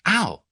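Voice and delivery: pinched yelp. A narrator's reading. Tuppence yelping at Tommy's pinch, high yelp.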